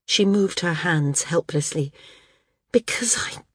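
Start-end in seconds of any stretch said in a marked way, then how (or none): 2.7-3.4 broken whisper. Acted. Maris breaks down confessing uselessness.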